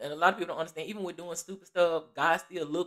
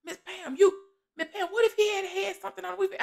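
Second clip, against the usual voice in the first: pleading, climbing higher each time; imitating the shop girl; 12 semitones higher than usual. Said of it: "She was like".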